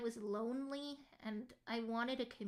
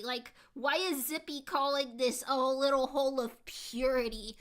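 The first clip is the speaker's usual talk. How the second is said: loud, incredulous protest; amused disbelief; 7 dB louder than usual. Real, not acted. Streamer questions a viewer calling the chat pure, loudly incredulous. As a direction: raise your voice in playful disbelief.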